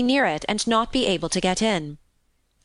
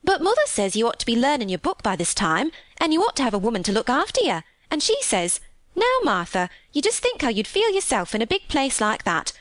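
higher, lilting Yorkshire maid; chatty country housemaid; 4 semitones higher than usual. Acted. Maid relaying her mother's questions, chatty. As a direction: pass on mother's concern as a gossipy maid. Martha retells mother's advice.

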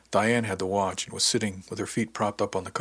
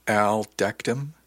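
stunned, slow, rising "Al decked him?"; incredulous slow question; 5 dB louder, much slower than usual. Acted. Ish repeats the news of Al's fight in slow disbelief. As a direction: drag it out in disbelief.

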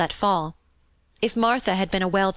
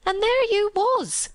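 much higher, slow, let-down; high slow disappointed maid; 13 semitones higher, slower than usual. Acted. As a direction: draw it out in disappointment.

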